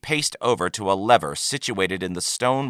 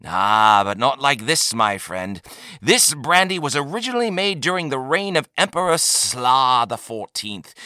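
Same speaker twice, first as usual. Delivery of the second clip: salesman trader; 5 semitones higher than usual. A narrator's reading. Trader selling rare brandy to a customer, showy salesman. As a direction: sell it grandly, then linger on the age.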